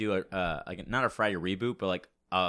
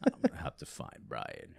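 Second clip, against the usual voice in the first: much lower, raspy Vin Diesel; deep rasp, softer; 9 semitones lower, 5 dB quieter than usual. Pretend. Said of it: "a mixture between deep and just really fucking raspy".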